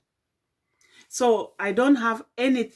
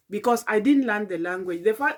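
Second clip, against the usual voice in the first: normal talking voice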